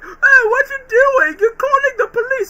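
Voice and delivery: very high, loud crying shout; crying panic. Retold or imitated. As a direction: wail it like a frantic crying kid. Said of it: "and he's like".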